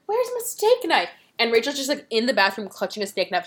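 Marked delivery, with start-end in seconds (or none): 0.1-1.1 high shrill question. Retold or imitated. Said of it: "she's like".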